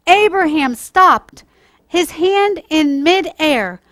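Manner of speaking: put-on storytime voice for children, lively and clear; gentle storyteller reading to kids; story narration. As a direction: read it like a bedtime Bible story.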